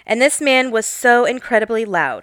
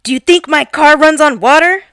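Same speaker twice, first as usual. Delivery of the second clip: retold angry yell; 5 semitones higher, 7 dB louder than usual. Retold angry customer yelling.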